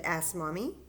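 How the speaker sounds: ordinary delivery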